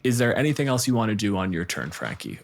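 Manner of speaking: ordinary talk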